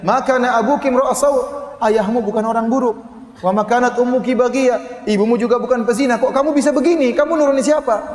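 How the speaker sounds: scandalised villagers scolding Maryam; accusing crowd. Acted. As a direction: confront her as scandalised neighbours. Voices the accusing people.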